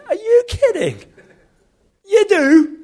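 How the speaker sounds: high, loud, incredulous questions; outraged disbelief. Retold or imitated. Son talking back to God, loudly incredulous. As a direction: act floored and exclaim it loudly. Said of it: "I thought that was outrageous".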